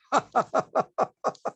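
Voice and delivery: high, rapid chuckle in short bursts; real staccato giggle at his own mimicry; amused laugh. Real, not acted. Priest laughing at the busybodies he just imitated, amused.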